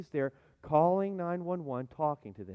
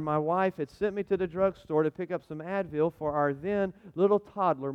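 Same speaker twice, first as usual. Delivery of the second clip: usual speaking voice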